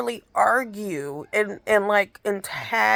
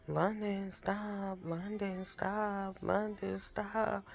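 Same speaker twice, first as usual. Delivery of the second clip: much softer repeated nagging, barely voiced; muttered under the breath; 11 dB quieter, slower than usual. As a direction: grumble it to yourself over and over. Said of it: "whispering under his breath".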